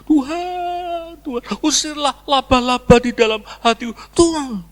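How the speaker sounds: high-pitched wailing plea to God; mock tearful prayer. Congregant who arrives already weeping, imitated high tearful prayer. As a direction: imitate a worshipper crying out in prayer. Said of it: "datang langsung nangis".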